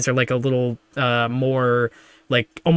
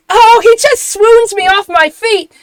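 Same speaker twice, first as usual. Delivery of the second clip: high, loud lovestruck falsetto; swooning admirer; 11 dB louder than usual. Acted. Admirer smitten by the arriving man, high swooning cry. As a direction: swoon it out, hammed up.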